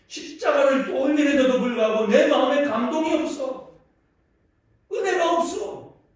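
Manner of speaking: high, very loud impassioned lament; anguished outcry. Acted. Preacher acting Spurgeon lamenting lost grace to his wife, anguished shout. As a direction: cry out in acted spiritual anguish.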